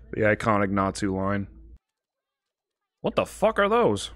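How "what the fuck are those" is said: high-pitched real disbelief; startled bafflement. Real, not acted. Viewer spotting something odd on screen, startled bafflement. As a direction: ask it high, genuinely baffled.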